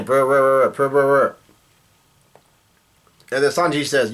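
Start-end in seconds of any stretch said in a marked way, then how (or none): 0.0-1.4 trilled, repeated ringing noise; imitated phone ringing. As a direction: mimic a ringing phone with rolled brrs. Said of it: "Mushu starts bringing".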